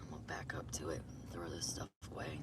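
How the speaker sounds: hushed whisper. Real, not acted. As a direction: whisper it under your breath. Whispered aside to camera.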